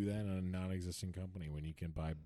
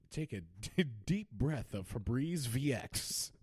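ad announcer; 5 semitones higher than usual. Retold or imitated. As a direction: sell it like an air freshener ad. Mock ad voice.

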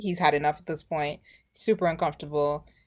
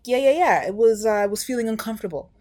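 high, louder rush of mock justification; hurried excuse; 5 semitones higher, 4 dB louder, faster than usual. Man justifying himself to her, mock flustered. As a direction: brush it off quickly, pitched up.

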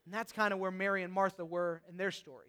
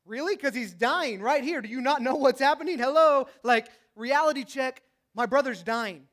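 louder and higher, frustrated pushback; exasperated disbelief; 3 semitones higher, 9 dB louder than usual. Pretend. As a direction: snap back at hollow comfort. Sister's exasperated retort.

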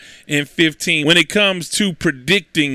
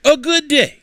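high, louder showman title announcement; announcer title call; 10 semitones higher, 3 dB louder than usual. Acted. Host announcing his weekly segment, hyped announcer call. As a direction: sell the segment name like a jingle. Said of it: "a segment that is called".

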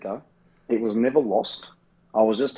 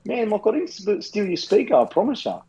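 imitating Gav pleading; 9 semitones higher than usual. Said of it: "He's like".

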